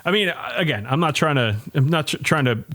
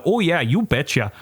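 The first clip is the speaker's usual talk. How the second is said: mock Upper Midwest lilt; Minnesota accent; 3 semitones higher than usual. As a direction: imitate a folksy Minnesotan, long O. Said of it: "like, Minnesota, like, accent".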